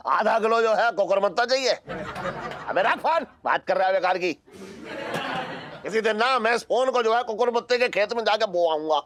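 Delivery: high-pitched, exasperated rant; worked-up phone grumbling; irritated outburst. Acted. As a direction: snap indignantly at a nuisance caller. Irritated by a nonsense phone order.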